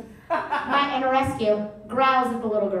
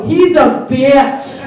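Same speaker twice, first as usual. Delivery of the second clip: slow, much louder, laughing punchline; triumphant jab; 3 semitones higher, 14 dB louder, much slower than usual. Pretend. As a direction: land the insult with glee. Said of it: "look at my dog and slurp".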